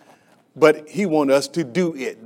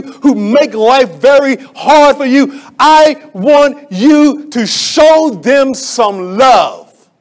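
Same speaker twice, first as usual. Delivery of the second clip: loud, high, insistent sermon climax; preaching at full force; 7 semitones higher, 8 dB louder, slower than usual. Real, not acted. Preacher urging the church to pray for haters. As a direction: build and hammer it to the congregation.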